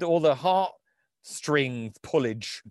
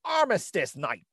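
put-on a lightly higher New York newsreader accent; 5 semitones higher than usual. Host reads the 1931 review in light New York accent. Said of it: "this is how they talk in New York City".

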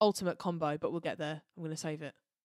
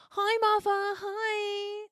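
much higher chirpy greeting; imitating the Doctor; 13 semitones higher, 4 dB louder, much slower than usual. Fan imitates the Doctor greeting Martha, chirpy.

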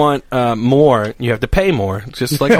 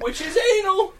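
laughing; 7 dB quieter than usual. Real, not acted. Laughing outburst mid-joke.

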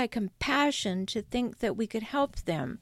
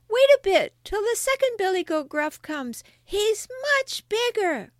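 very high, pleading little goat; small goat voice; 11 semitones higher, 5 dB louder than usual. Acted. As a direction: plead as the tiniest billy goat. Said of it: "with such a small voice".

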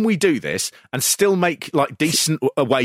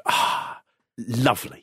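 sighed, low and soft; savouring delight; 4 semitones lower, 5 dB quieter, slower than usual. Retold or imitated. Host acts out hearing the wrestler's accent, low savouring sigh. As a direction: breathe out a contented sigh, then low. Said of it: "I go".

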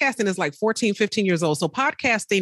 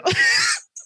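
very high loud shrieking laugh; high joyful shriek of laughter; whooping laugh; 4 dB louder than usual. Real, not acted. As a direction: burst out in a whooping cry of joy. Overjoyed at the fee.

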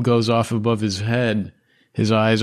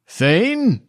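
"Thane?" shouted much higher and louder; calling out loud; 10 semitones higher, 3 dB louder than usual. Acted. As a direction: call out for the lost dog across the grounds. NPC calls again for dog.

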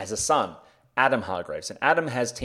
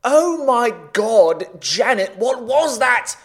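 loud, high-pitched disbelief; aghast and shouting at a colleague; appalled outburst; 10 semitones higher, 9 dB louder than usual. Acted. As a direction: cry out in horrified exasperation. Appalled at the terrible accent.

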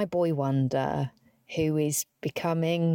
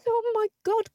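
moved gasp. Retold or imitated. Moved by crying man.

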